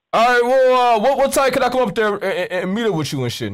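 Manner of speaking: ordinary talk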